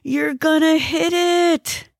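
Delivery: high, drawn-out warning cry; slow high-pitched touch of panic; mild alarm. Acted. Passenger warns driver of the tree, mildly alarmed high cry.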